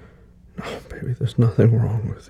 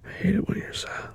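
tender whisper; 9 semitones higher, 6 dB quieter than usual. Acted. Comforting partner.

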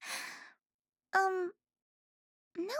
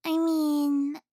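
ordinary talk

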